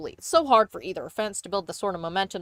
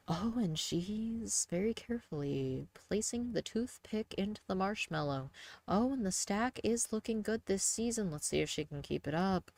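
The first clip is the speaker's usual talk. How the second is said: golf commentator; 11 dB quieter than usual. Retold or imitated. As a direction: quietly commentate like televised golf. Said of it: "talking like you're a fucking golf announcer".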